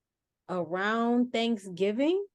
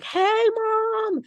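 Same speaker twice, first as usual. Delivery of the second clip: high, loud call to mom outside; yelling; 7 dB louder than usual. Retold or imitated. Daughter calling to her mom through the nursing home window, yelling. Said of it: "yelling in like".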